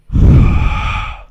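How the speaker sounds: loud, forceful huff of breath; exasperated sigh. Retold or imitated. Imitating a friend who just died in game, exasperated sigh. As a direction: imitate a fed-up gamer exhaling hard. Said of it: "when he died, he just went".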